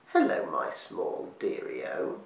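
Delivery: put-on low, slow creaky old witch; a sly crone luring her prey, slowly. A narrator's reading. Witch greets Jub in fog.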